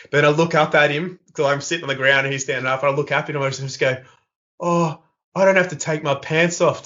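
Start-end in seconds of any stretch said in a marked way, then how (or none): 4.6-6.8 higher-pitched naive question; mock apprehension, pitched up; feigned innocent worry. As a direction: play the worried innocent. Feigned worry about strip search.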